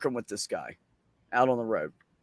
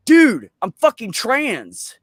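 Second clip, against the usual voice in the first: loud, slow, higher-pitched exasperation; exasperated retort; 7 semitones higher, 8 dB louder, much slower than usual. Retold or imitated. Coming out to the coworker who keeps picking, exasperated. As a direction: stretch out each word emphatically.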